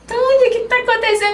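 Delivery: pergunta aguda e apressada de médico espantado; espanto imitado. Retold or imitated. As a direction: imite alguém pego de surpresa, tom alto e rápido. Médico surpreso com a invasão. (médico espantado, imitado)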